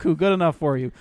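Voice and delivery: ordinary talk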